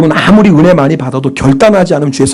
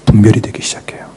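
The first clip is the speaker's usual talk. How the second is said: hushed, whispered revelation; 7 semitones lower than usual. Real, not acted. Preacher confiding to the congregation in a whisper. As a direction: whisper 분별이 like a secret worth leaning in for.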